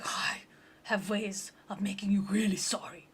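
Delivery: whispered mock-threat; menacing whisper. Demon master threatening his minion, whispered menace.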